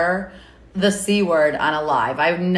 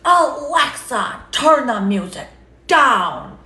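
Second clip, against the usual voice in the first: drawn-out, much higher mock yell at the speaker; imitating dad yelling; 9 semitones higher, 7 dB louder, slower than usual. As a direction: bellow it slowly like an angry dad. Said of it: "he's yelling at Alexa".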